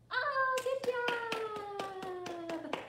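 high, loud, long-drawn cheer; excited praise. Real, not acted. Teacher praising kids after the second round, excited cheer. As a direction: cheer and stretch it right out.

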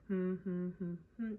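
soft low humming; hummed tune. Real, not acted. Teacher finding the song's starting note, soft humming. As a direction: hum a few notes to find the tune. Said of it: "It's to the tune".

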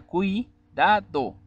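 "cui, da, do" as three separate beats; syllable by syllable. Shown as a demonstration. Teacher dividing "cui, da, do" into syllables. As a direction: tap out "cui, da, do" slowly. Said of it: "Separando en sílabas sería".

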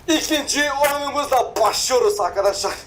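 high-pitched, upbeat host announcement; hyped video intro. Real, not acted. Host starting the second game, hyped. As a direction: announce it to viewers with high energy. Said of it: "videoya başladığında wow".

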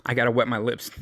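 ordinary talk, nothing marked

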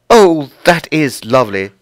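gasp, then loud, high delight; delighted admiration. Acted. Lucy admires the ironed frills, gasping with delight. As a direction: exclaim in admiration.

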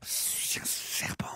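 slow hissing whisper; snake whisper. Pretend. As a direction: hiss it like a sly snake. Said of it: "tu fais le serpent".

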